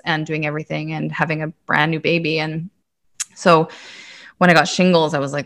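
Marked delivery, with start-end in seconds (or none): none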